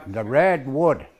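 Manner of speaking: red wood said slowly, stress on red; slow it down, lean on red; slow teaching. Shown as a demonstration. Elder teaching students a plant name, slow and deliberate. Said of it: "Really red".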